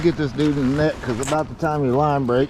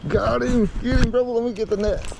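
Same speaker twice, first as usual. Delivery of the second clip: fighting a fish; 9 semitones higher than usual. Real, not acted. Fighting a fish, calling for net.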